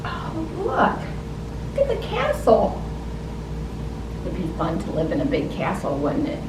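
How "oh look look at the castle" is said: usual speaking voice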